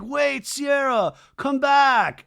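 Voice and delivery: high, loud pleading call; mock calling out. [name] calls after Sierra walking off, mock pleading shout. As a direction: call after someone leaving, hammed up.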